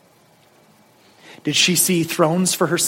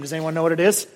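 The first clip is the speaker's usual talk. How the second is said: normal talking voice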